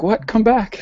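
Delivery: high-pitched whiny "come back"; high protest. Retold or imitated. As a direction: whine it like the wife. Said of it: "she's like".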